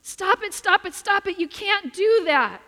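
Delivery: high, loud, urgent pleading to stop; frantic shouting. Retold or imitated. Son shouting at the neighbor boy stomping ants, frantic and loud. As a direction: yell it like a boy desperate to save the ants. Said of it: "son frantically trying to stop him".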